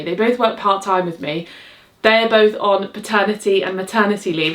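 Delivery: normal talking voice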